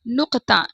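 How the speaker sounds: back-of-throat q in नुक्ता; नुक्ता with throaty q; nuqta with q. Shown as a demonstration. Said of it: "क्या बोलते हैं?".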